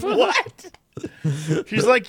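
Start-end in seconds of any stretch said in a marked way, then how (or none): 0.0-0.7 high shocked squeak of What?; a squeaky disbelieving What?; mock shock. Retelling the eye doctor visit, squeaky surprised What?. Shocked at blood pressure news.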